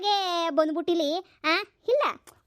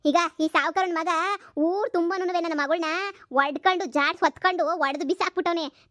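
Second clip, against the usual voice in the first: usual speaking voice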